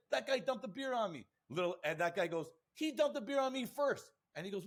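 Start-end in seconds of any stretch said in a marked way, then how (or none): 0.1-1.3 retold high-pitched complaint; indignant accusation. Soaked man tells the cop on the other guy, mock indignant. 2.8-4.1 high-pitched mock protest; indignant counter-accusation. Other guy protests to the cop, mock indignant.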